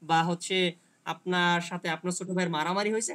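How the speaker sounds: usual speaking voice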